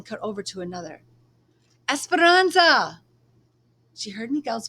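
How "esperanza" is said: loud long call across the fields, much higher. Acted. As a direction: call after her from far away. Miguel calling her from distance.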